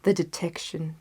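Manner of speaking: usual speaking voice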